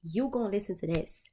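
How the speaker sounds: loud, bossy scolding voice; spiritual team scolding. Retold or imitated. Her spiritual team scolding her, loud and bossy. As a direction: bark it like a spirit guide laying down the law. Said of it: "my spiritual team was like".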